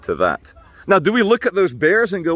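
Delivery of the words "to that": put-on low, soft German accent; German-accented impression, low and subdued. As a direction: imitate a grave German narrator, softly. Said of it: "in his wonderfully German accent".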